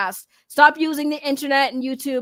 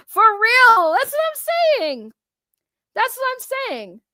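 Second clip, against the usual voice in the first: emphatic agreement; 9 semitones higher, 4 dB louder, slower than usual. Real, not acted. Emphatic agreement, slow and high.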